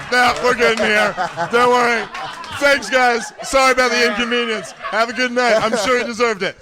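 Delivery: high, loud nervous politeness; sheepish retreat from the trunk; overly polite backing off. Retold or imitated. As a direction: apologise your way out fast. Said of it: "And he's like".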